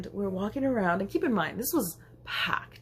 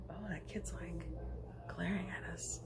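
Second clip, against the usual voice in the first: hushed whisper to a friend; 13 dB quieter than usual. Retold or imitated. Two friends at the table noticing a glaring toddler, whispering. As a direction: keep it secret from the kid.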